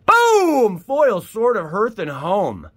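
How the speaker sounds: very high, loud triumphant shout; excited boom. Real, not acted. Revealing a foil to viewers, a big triumphant shout. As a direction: slam the reveal home.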